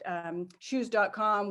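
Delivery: normal talking voice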